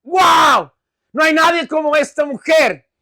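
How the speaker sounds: loud, high gushing cry; hammed-up amazement; outburst of emotional praise. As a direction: exclaim as an overawed believer. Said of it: "aquí se desata una expresión".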